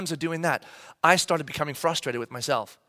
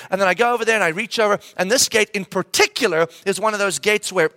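ordinary delivery

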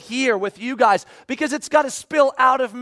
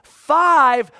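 higher, louder call of the number; loud count; 5 semitones higher, 7 dB louder than usual. Retold or imitated. Pastor counting down to his kid, loud call. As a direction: shout it toward the car.

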